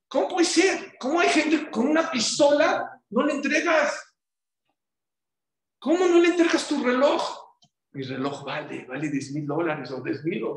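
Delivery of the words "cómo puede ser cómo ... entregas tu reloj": high, loud disbelieving cry; incredulous outcry. Real, not acted. Preacher's disbelief at people's priorities. (grito incrédulo, agudo y fuerte)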